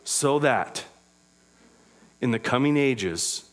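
low, soft, reverent Bible reading; hushed reading of Ephesians; scripture reading. Acted. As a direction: read the verses gently.

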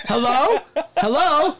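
mock calling into phone. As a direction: call out like checking a dead line. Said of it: "He's all".

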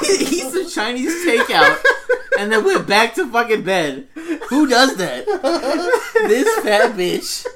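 loud, high rant through laughter; laughing outrage. Real, not acted. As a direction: crack up while ranting at a friend. Mock outrage while everyone laughs.

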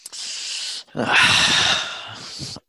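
long, heavy exhale; long sigh. Real, not acted. Co-host weighing the criticism of Durant, long sigh. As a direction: let out a drawn-out sigh. Doubtful response to co-host's criticism.

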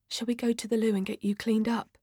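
soft, quick, discreet suggestion; low voice. Acted. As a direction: quietly offer help, hurrying gently. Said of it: "says in a low voice".

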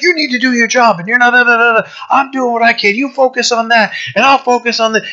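high-pitched mock team bickering; frustrated. Teammates turning on each other when losing, frustrated. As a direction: act out exasperated squabbling, pitched well up. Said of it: "they're getting so frustrated".

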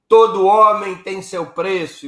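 weighty pronouncement, drawn out; Getúlio's line. Retold or imitated. Getúlio declaring to his cabinet, slow and solemn. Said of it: "ele diz aquela frase clássica".

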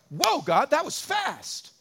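hammed-up astonished prayer; mock amazement. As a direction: gasp it out as if God answered instantly. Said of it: "prayed to God and was like".

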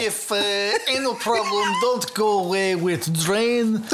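put-on Russian accent. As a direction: ham up a Russian surgeon. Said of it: "accent slipped into Russian".

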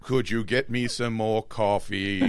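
mock deep voice.

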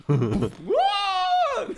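high, loud shout of surprise; excited whoa. Acted. Friend imagines a burning car, excited shout. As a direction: yell it like watching a crash.

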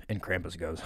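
ordinary talk, nothing marked

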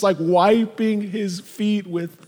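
ordinary delivery

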